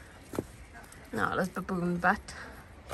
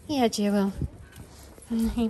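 ordinary talk, nothing marked

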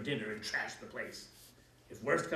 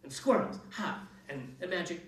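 higher, louder scoffing list; mocking witch; 8 semitones higher, 4 dB louder than usual. A narrator's reading. Witch mocking the fairy tale to Kat, scoffing. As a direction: sneer at each magic thing. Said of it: "hissed the witch".

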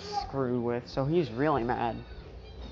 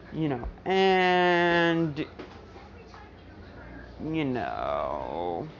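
ordinary delivery

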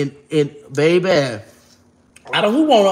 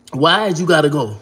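normal talking voice

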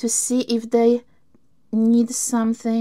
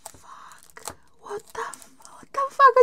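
shocked whisper; 14 semitones higher than usual. Real, not acted. Shocked at gift.

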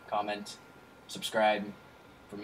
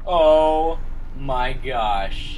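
startled; 2 semitones higher, 10 dB louder, much slower than usual. Real, not acted. Startled by a jump scare.

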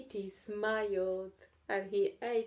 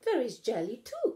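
louder calling; 4 semitones higher, 6 dB louder than usual. A narrator's reading. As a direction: call out the treat. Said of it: "called Katy".

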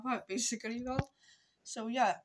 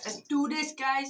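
hyped, a bit louder and higher; pumped up; 4 semitones higher, 4 dB louder than usual. Real, not acted. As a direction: psych yourself up for it. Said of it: "Right, let's brush my teeth".

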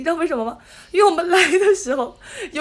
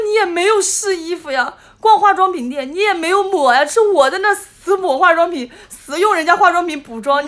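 indignant complaint; 5 semitones higher, 3 dB louder than usual. Retold or imitated. Retelling her own indignant complaint.

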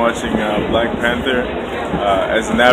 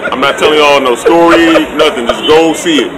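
loud, high emphatic promise; loud insistence; 5 semitones higher, 7 dB louder than usual. Real, not acted. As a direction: insist passionately to the crowd. Urging everyone to see film.

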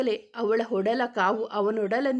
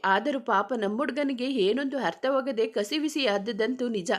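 usual speaking voice

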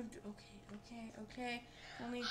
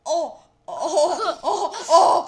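loud laughing yelps; real laughing outcries; laughing shouts; 4 semitones higher, 15 dB louder, much slower than usual. Real, not acted. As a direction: burst out at the screen. Laughing at game mishap.

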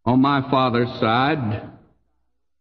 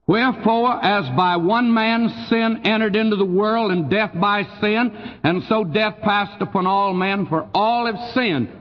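raised, measured Bible reading from the pulpit; scripture reading; 4 semitones higher than usual. Acted. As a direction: read the verse aloud to the congregation. Reads scripture aloud in sermon.

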